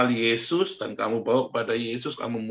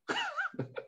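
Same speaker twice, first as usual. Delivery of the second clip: very high, far-off cackle; shrill mocking laugh; 10 dB quieter than usual. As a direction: squeal a laugh at the fool. Mocking laugh after insult.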